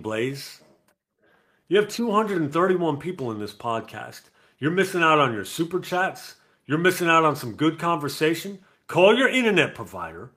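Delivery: loud, high teasing scold; loud playful telling-off of a friend; mock scolding. Real, not acted. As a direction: scold him for the whole chat to hear. Scolding absent friend on stream.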